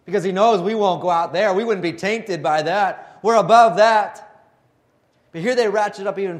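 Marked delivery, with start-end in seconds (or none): 0.0-4.5 hammed-up pious scoffing, pitched high; mocking sneer. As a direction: sneer it out as the proud religious leaders. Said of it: "there they mocked and said".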